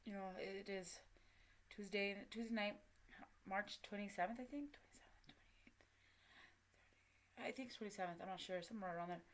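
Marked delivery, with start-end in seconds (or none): 4.8-5.8 whispered counting to herself; a voiceless aside to herself. Real, not acted. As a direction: breathe the dates under the breath. Muttering dates to herself.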